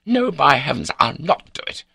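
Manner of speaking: high, loud outburst; raging refusal. A narrator's reading. Baronet wheeling about at the door, angry. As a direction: explode in indignant refusal. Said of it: "in full raging fettle".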